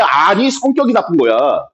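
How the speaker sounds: ordinary talk, nothing marked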